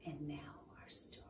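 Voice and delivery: hushed whisper to open the story. Acted. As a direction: lean in and whisper the start.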